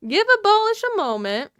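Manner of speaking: high, loud mock plea; playful call for patience. Streamer repeating the plea to chat, playful high call.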